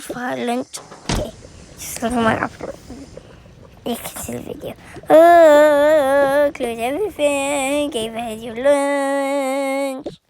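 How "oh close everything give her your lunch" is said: put-on drawn-out odd accent. Child playing mom packing lunch, odd drawn-out voice. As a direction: stretch the words out in one long droning line. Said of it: "Why do I sound like that?".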